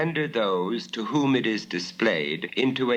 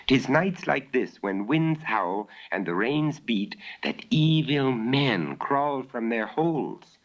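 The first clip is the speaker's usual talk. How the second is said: higher voice of a little maid, ominous; maidservant Bella; 6 semitones higher than usual. Acted. Maidservant warning of evil men on stormy nights, higher. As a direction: voice the young maid spooked by the storm. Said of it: "declared the little maidservant Bella".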